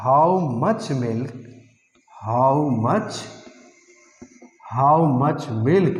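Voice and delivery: drawn-out words with pauses between phrases; slow dictation. Shown as a demonstration. Teacher building a question about milk for students, slow dictation. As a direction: dictate slowly, repeating as you write.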